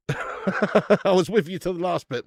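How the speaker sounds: laughing disbelief at the last line. Real, not acted. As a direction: laugh your way through the reply. Laughing at viewer's message.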